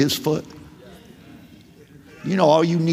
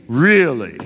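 lower, flat, doubting "Really?"; sarcastic really; 6 semitones lower than usual. Acted. Doubting Israel answering God with sarcasm. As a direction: drop "Really?" down low with a sarcastic fall. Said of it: "Can you detect the sarcasm he's using here".

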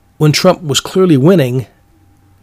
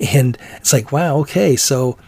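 ordinary delivery

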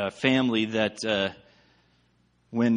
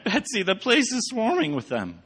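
alarmed Corrie; 12 semitones higher than usual. A narrator's reading.

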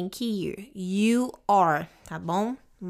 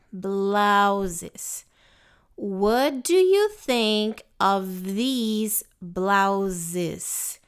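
very slow model; much slower than usual. Shown as a demonstration. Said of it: "pegar a pronúncia".

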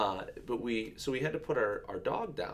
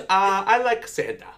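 put-on much higher, louder little-girl voice; imitating little daughter; 12 semitones higher, 12 dB louder than usual. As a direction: play the toddler proudly announcing. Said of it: "And she goes".

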